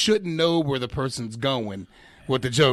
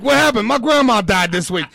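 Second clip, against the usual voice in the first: mock needy comic; 8 semitones higher, 8 dB louder than usual. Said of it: "The next week".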